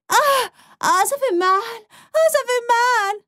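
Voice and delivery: high, whiny faked pain wail; fake crying. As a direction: ham up the hurt to fool him. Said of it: "fait semblant d'être blessée. Et j'ai pleuré".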